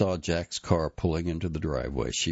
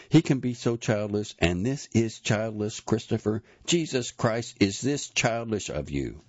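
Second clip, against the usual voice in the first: higher, exasperated rebuke; angry scolding; 6 semitones higher, 3 dB louder than usual. A narrator's reading. Anne berating Christopher for being childish, exasperated. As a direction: keep the scolding pitched up and pressed. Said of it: "so openly yelled at by his wife".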